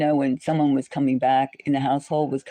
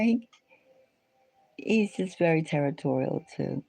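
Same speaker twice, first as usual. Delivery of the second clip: ordinary talk, nothing marked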